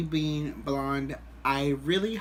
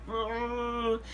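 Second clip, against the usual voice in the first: high, softer drawn-out groan; mock moan; 6 semitones higher, 5 dB quieter than usual. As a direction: let out a long mock whine. Acts out his overwhelmed reaction.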